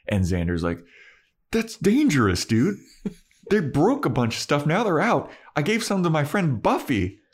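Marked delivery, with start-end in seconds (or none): 1.5-7.2 high, nervous, half-laughing protest; whiny Xander. Retold or imitated. Xander warning the boss about Buffy, high nervous protest. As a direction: squeak out the worry, laughing nervously.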